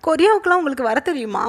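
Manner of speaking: high-pitched, amazed questioning; astonished. A narrator's reading. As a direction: ask in rising amazement, pitch lifted. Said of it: "வைனுக்கு பயங்கர ஆச்சரியம்". (ஆச்சரியத்தில் உயர்ந்த குரலில் கேள்வி)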